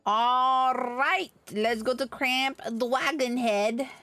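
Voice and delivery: ordinary talk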